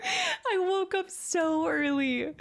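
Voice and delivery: high, slow giggly confession; laughing slowly. Real, not acted. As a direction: stretch it out through a laugh. Laughing about waking early.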